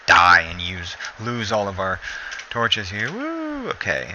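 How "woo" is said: sudden high whoop of joy; bright high celebratory woo; excited whoop. Real, not acted. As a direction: let out a quick cheer. Celebrates after saving torches.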